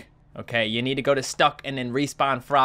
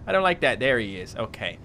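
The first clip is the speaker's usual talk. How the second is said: usual speaking voice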